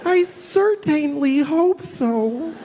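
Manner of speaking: high, slow, sweet worried voice; mocking his younger innocent self; naive young self. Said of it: "When I was, like, twenty".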